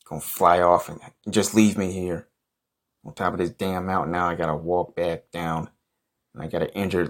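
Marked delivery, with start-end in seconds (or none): none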